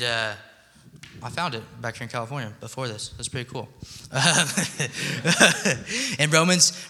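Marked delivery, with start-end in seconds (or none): 4.1-6.9 louder, higher, laughing through the words; real laughing. Real, not acted. Preacher returning to his verse after a laugh, laughing. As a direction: get back on track through a laugh. Laughing while resuming the sermon.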